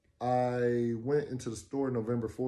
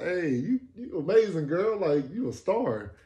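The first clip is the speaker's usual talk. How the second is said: hyping her up; 7 semitones higher, 7 dB louder than usual. Retold or imitated. Hyping her up after the dance.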